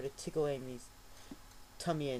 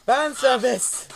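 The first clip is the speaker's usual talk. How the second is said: slow, high, loud exclaimed catchphrase; fan service exclamation; 11 semitones higher, 15 dB louder, slower than usual. Pretend. Reader hamming up the fanfic's racy moment, slow loud shout. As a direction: belt it out like a cheesy announcer. Comic dramatic reading aside.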